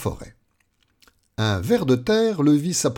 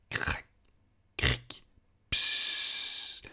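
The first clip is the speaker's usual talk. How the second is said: quiet imitation of crackling cereal; softly crackle and fizz like cornflakes; cereal crackle noises; 13 semitones lower, 8 dB quieter, much slower than usual. A narrator's reading. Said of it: "firent les cornflakes". (petits bruits de céréales qui crépitent)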